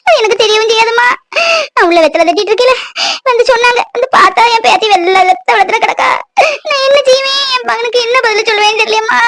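put-on old grandmother's voice, sobbing through her story; tearful grandmother. Grandmother tells the nurse how her granddaughter got hurt, tearful.

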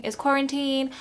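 ordinary delivery

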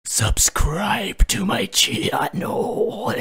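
very loud, high, drawn-out roar; hammed-up yell. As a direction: bellow the plug, stretching the last word. Over-the-top sign-off plea.

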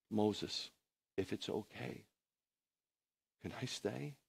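very soft, halting plea; timid request, acted. Joshua asks Moses to let him stay, soft hesitant request. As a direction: ask hesitantly, almost under the breath.

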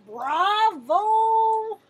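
loud, high cry of bravo; a loud, high bravo from the lion judge; delighted praise. A narrator's reading. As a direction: cheer the student on like a proud teacher. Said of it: "said lion".

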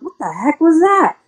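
high startled yelp, just woken up; a sudden startled cry. Retold or imitated. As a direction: jolt awake and blurt it out. Woken by hitting a deer.

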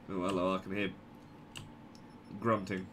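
ordinary talk, nothing marked

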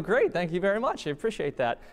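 pleased taxpayer. Retold or imitated. Voices pleased taxpayers getting money back.

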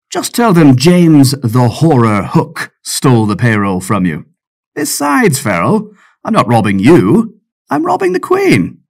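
swaggering pirate voice, pitch leaping up; cocky pirate. Acted. Pirate James boasting to Farrell about robbing the Queen, cocky swagger. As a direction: boast it like a smug rogue. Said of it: "His cocky voice gave him away".

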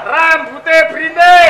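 high, very slow proclamation; loud declamation. Acted. Regent listing the prit gandil woman's traits, declaiming slowly.

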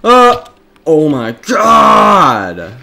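loud, slow, high "oh my god"; shocked shout. Real, not acted. Sudden shock in game.

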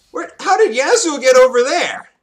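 loud, high-pitched, startled question; real baffled yelp at the game; incredulous outburst. Real, not acted. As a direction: blurt it out loudly in disbelief. Baffled by enemy's sudden appearance.

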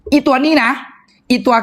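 high excited pitch for this one; high, eager seller's cry. Real, not acted. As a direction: hype the product up.

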